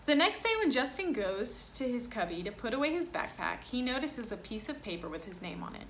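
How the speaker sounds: lively picture-book reading voice; reading to children. Acted. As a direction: read it aloud to a class. Reads picture book to class.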